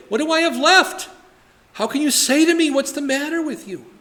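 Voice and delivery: high, pleading protest; plaintive cry. Acted. Micah crying out to the Danites, high and aggrieved. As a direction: read Micah's complaint as a wounded wail.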